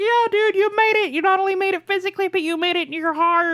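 very high, gushing encouragement; emotional cheering. Retold or imitated. Viewer to Chance reaching home, overcome with pride. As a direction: cheer the dog on as if close to tears.

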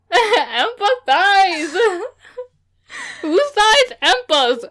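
very slow, high, laughing. Real, not acted. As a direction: laugh the word out slowly. Laughing at failed word.

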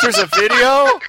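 high, loud, aghast; can't believe what he's seeing; real horrified surprise; shocked disbelief. Real, not acted. Disbelief at the video.

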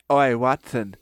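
lightly put-on British scientist voice; mock British accent. Crick greets his lab partner Watson, in a mock British accent. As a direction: play at being a British researcher.

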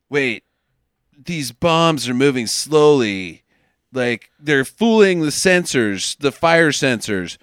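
slow, low, deliberate musing; slow realisation. Retold or imitated. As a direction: piece it together aloud, word by word. Acting out the hero's slow realisation.

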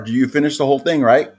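talking through a mouthful of nuts; chewing while talking. Real, not acted. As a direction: speak while munching nuts. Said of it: "Sorry, I'm munching on some mixed salted nuts".

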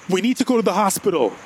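fast, high, urgent insistence. Acted. Narrator urging bloodied James toward hospital, urgent. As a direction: rush it out in alarm. Urgent plea to injured friend.

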